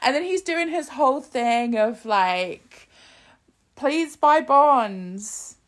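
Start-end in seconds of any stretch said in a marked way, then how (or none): 3.7-5.6 higher, slow, earnest hero selling war bonds; drawn-out patriotic appeal, a light imitation; Captain America pitch. Captain America urging the troops to buy bonds, slow and earnest. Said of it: "where Captain America goes to visit the troops".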